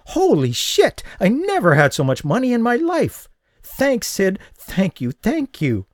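high, breathless delight; tipsy woman overjoyed at her win; giddy excitement. Acted. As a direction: gush with joy and gratitude. Drunk winner gushing thanks.

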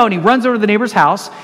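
ordinary delivery

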